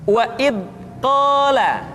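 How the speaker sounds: "wa id qala" with "id" stopped dead before "qala"; held-back dz. Shown as a demonstration. Teacher showing first mistake, "id" blocked before "qala". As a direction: cut the sound after "id", leaving a gap. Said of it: "suara yang seringkali tertahan".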